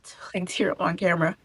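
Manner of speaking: fighting tears, voice breaking to a whisper; holding back tears. Real, not acted. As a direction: try not to cry on camera, barely getting the words out. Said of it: "I'm also trying not to cry".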